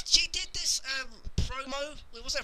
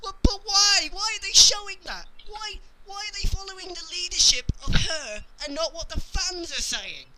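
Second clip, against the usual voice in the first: higher, frustrated demanding; exasperated rant; 6 semitones higher than usual. Real, not acted. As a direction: ask why in real exasperation. Frustrated rant about the fans.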